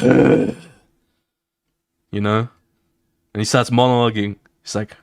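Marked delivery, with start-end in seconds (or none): 0.0-0.6 whispered groan. Retold or imitated.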